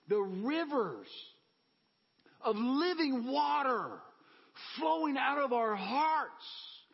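slow drawn-out preacher line; sermon climax about living water; preaching. Real, not acted. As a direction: stretch each word, rising. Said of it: "preaching image".

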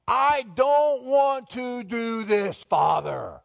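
slow, high, loud anguished plea; Jesus. Acted. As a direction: cry out to a father in desperate prayer. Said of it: "prayer Jesus is praying".

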